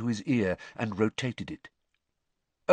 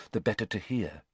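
plain reading voice

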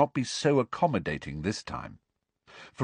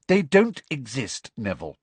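tart; 5 semitones higher, 4 dB louder than usual. A narrator's reading. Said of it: "said Hermione tartly".

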